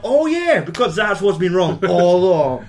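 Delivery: high, loud sarcastic sneer; mock him with big fake agreement. Fletcher mocking the drummer adjusting his seat, loud sarcastic sneer.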